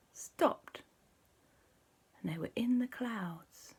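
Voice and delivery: soft, whispery narration; hushed suspense. A narrator's reading. Storyteller as the roller coaster stops, hushed suspense. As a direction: drop to a hush for the halt.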